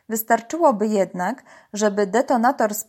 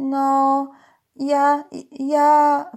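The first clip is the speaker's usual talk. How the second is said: hesitant, higher kitten voice; shy; 5 semitones higher, 4 dB louder, much slower than usual. A narrator's reading. Kitten timidly answers her uncle, shy and hesitant. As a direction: say it shyly, stalling on the words. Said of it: "powiedziała nieśmiało kotka".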